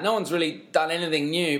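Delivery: ordinary delivery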